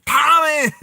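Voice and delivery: high, loud call of Tommy; sudden high shout of Tommy!; shouted name. Real, not acted. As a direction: yell his name out.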